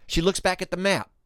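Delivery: ordinary delivery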